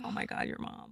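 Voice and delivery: lightly acted, near-whispered awe; very quiet, whispery imitation; hushed quote. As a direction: quote a friend in a soft whisper. Retelling friend's message about mom.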